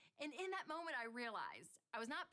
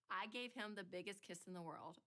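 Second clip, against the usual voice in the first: normal talking voice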